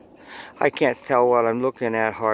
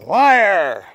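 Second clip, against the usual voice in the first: higher, louder mock yell of liar; playful yell; 7 semitones higher, 5 dB louder than usual. Teacher yelling for Harmon's amusement, playful higher shout. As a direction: shout it like teasing a kid. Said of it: "Harmon loves it when I yell".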